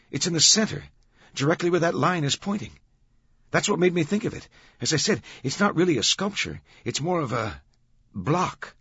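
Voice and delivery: high, animated guard's voice; guard explaining. Acted. Guard tells them where the block lies, explanatory. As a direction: explain eagerly, pitch well up.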